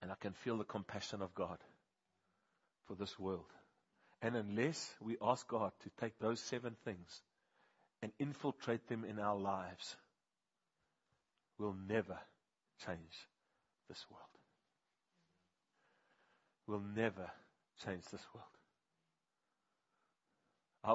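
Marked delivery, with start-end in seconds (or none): 0.0-3.5 tender. Real, not acted. Tender confession of God's compassion. 11.6-18.5 fading solemn. Real, not acted. Solemn closing warning.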